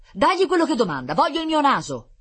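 high, loud, insistent order; desperate demand. A narrator's reading. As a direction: demand it back at any cost. Master desperately orders servant.

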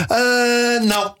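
put-on high childish dismissive ah não; imitating daughter. Father mimics his daughter ignoring him, high childish voice. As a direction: brush it off like a bored toddler. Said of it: "ela olha para o telemóvel e faz assim".

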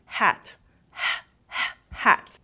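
hat with a breathy h from the throat, like breathing out; breathy h demo. Shown as a demonstration. Teacher demonstrating the English h in hat to students. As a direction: push air for the h in hat. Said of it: "a gente vai fazer".

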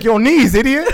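high, loud mock scolding; imitating a fed-up instructor shouting; exasperated yell. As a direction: yell it through a laugh.

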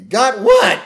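high, loud puzzled holler; mock shouted question; calling back up. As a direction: yell back from below. Barney yelling back up from below.

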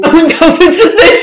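laughing at his slip in disbelief. Real, not acted. To Chase after his covid slip, laughing disbelief.

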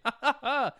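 light posh ha-ha-ha; regal chuckle. Acted. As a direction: chuckle like a regal British Gollum. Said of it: "He chuckles afterwards".

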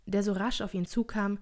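ordinary delivery, nothing marked

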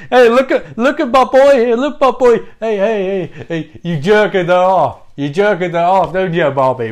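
high, loud mocking heckle; rowdy jeering. Frankenstein loudly jeering at Bobby in front of Annie. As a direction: bellow it like a rowdy mate teasing.

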